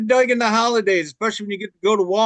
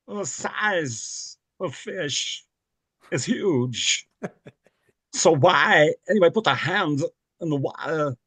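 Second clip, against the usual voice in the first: slow, halting Walken cadence with odd pauses; lightly voiced, very slow Christopher Walken; Christopher Walken impression; 2 semitones lower, slower than usual. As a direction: drag out each phrase like Walken. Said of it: "Christopher Walken".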